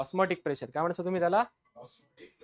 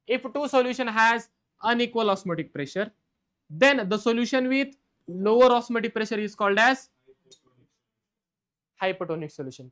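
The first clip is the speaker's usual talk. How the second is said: dictating each word slowly for notes; slow dictation; 3 semitones higher, 4 dB louder, much slower than usual. Shown as a demonstration. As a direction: spell the definition out slowly so students can write. Dictates definition to class.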